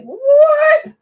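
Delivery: high, loud, drawn-out what; mock-astonished, voice shooting up; stunned squeal of disbelief; incredulous reaction. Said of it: "And I'm like".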